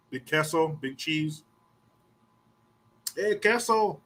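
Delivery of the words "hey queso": put-on higher-pitched kid calling out a nickname; teasing kids. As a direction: call it out like a schoolkid. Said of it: "All the Mexican kids".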